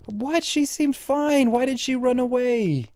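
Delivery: shocked disbelief. Real, not acted. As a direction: sound genuinely thrown by the news. Reacting to psychiatrist fleeing.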